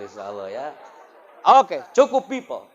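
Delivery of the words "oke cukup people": loud, high call for silence; teacher calling the crowd to stop. Real, not acted. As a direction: grab the room's attention. Quieting the crowd.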